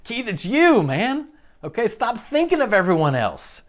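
high-pitched, imploring confrontation; acted exasperated appeal, pitched well up; Jesus. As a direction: plead as if holding someone by the shoulders. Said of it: "hold us by the shoulders and say".

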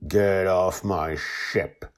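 slow, weighted order without shouting; low, forceful command. A narrator's reading. Joy orders the Guardian off her ship, low forceful voice. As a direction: say each word slowly with contained force. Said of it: "in a low voice that carries more force".

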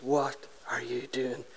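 slow, menacing low growl of a landowner; low mock threat with heavy pauses; pumped with anger. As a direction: imitate a furious man, word by word. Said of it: "He is pumped with anger".

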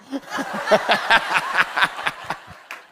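long, high belly laugh; helpless laughter. Real, not acted. Preacher whose fishing demo went funny, helpless laughter. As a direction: crack up completely.

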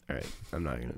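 ordinary talk, nothing marked

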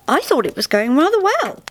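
breezy, wide-eyed innocence; innocently. A narrator's reading. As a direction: sound blameless and pleased. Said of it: "said the spirit innocently".

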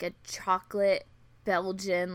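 ordinary delivery

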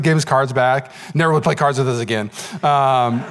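ordinary talk

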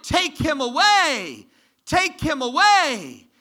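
high, loud, slow crowd shout. Retold or imitated. Preacher voicing the crowd's cry to Pilate, slow loud shout. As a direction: hurl it like an angry mob. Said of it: "But they shouted".

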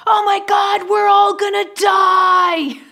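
high, loud screaming panic; panicked scream. Retold or imitated. Captain screaming at passengers in a storm, panicked shriek. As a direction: shriek it like the ship is going down. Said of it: "the captain comes out and screams".

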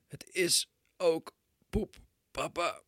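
put-on high, very slow strained child's voice fading to a whisper; a toddler straining on the toilet; groaning little boy. Son on the toilet telling his father, strained high groan. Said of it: "halverwege kreunde".

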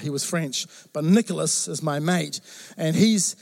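normal talking voice

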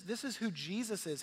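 ordinary delivery

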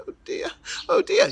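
high, fluttery worried cry; fretting bird. Acted. The wounded bird fretting before the king, high worried. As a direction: fret like a small wounded bird.

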